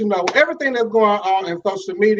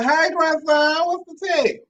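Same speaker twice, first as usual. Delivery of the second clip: much higher, playful hello to a viewer; sing-song greeting; 11 semitones higher, slower than usual. Real, not acted. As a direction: lift the voice up high and tease.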